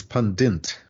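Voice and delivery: "pundint" said PUN-dint, with an added N; extra N. Shown as a demonstration. Showing a common error, "pundint" with extra N. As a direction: slip an N before the last T in "pundint". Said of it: "they put an extra N in".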